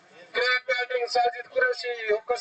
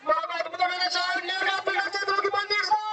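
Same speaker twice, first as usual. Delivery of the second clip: high, shouting wicket call; excited cricket commentary; 6 semitones higher than usual. Real, not acted. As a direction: erupt as the catch is taken. Wicket falls, commentator erupts.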